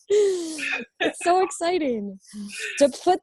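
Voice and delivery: slow, high, laughing through tears; teary laughing. Real, not acted. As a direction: stretch it out, half sobbing with joy. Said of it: "You're crying".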